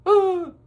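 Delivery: short, high, loud "ah"; mock frightened cry. Storyteller reacting to the missing footprints, mock scream. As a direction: cry out in playful alarm.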